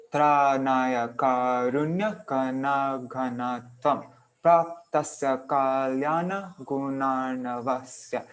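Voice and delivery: very slow, syllable by syllable verse reading; slow recitation. Shown as a demonstration. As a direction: lay out each syllable of the verse. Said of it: "this is following this exact pattern".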